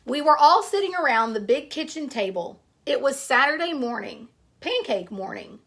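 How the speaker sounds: storybook read-aloud to a class; story narration. Acted. Teacher reading a picture book to her class, storytelling voice.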